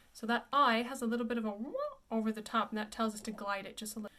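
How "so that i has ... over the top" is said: usual speaking voice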